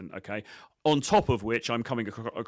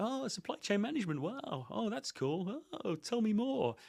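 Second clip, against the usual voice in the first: high, gushing fake enthusiasm; mock-impressed simpering voice; fawning chump; 12 semitones higher, 5 dB quieter than usual. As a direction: play the nodding-dog yes-man, way up in pitch. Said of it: "that nodding dog chump who's like".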